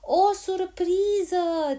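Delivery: high, loud, very stretched shout; drawn-out girl's yell; shrieked to be heard. A narrator's reading. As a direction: yell it slowly for deaf ears. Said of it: "țipă Polly ca să o facă să audă".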